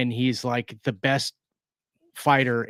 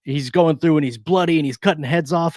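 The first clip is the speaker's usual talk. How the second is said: ordinary talk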